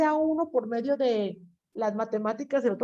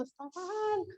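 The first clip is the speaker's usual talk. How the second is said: high, insistent scolding voice climbing higher; nagging brain; 8 semitones higher than usual. Retold or imitated. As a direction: plead with them to listen. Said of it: "el cerebro les está diciendo".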